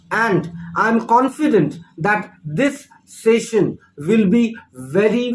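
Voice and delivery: usual speaking voice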